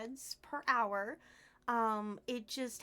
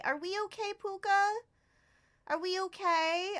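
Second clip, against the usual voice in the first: ordinary delivery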